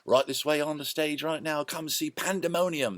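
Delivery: high carnival barker call; fair barker. Retold or imitated. Drawing fairgoers to the stage, high barker call. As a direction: hawk the show to passing crowds. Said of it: "Hawking them".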